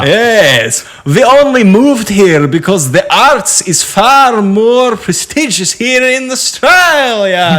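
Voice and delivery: loud, high, slow hammed-up Russian accent; bad Russian accent, pushed high and loud; cartoon Russian accent, slow and loud; mock Russian accent. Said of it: "not a Russian accent".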